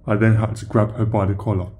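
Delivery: usual speaking voice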